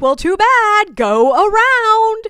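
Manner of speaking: high, very loud, drawn-out mock yell; mock screamed retort. Selfie woman refusing to move for the tour, mock screaming. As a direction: yell it dismissively, stretching the last words.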